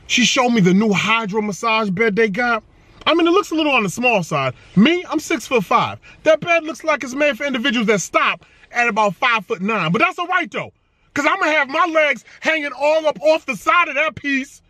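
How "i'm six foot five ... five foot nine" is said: usual speaking voice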